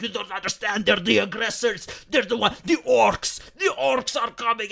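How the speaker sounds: hammed-up alarm about the orcs; mock hostile Twitter user. Mocking hostile Twitter users.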